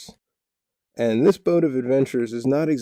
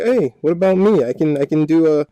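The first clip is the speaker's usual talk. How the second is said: higher, louder eager pipe-up; voicing a keen little show-off; eager boast; 5 semitones higher, 6 dB louder than usual. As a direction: pipe up excitedly to get noticed. Acted eager boast of Remy.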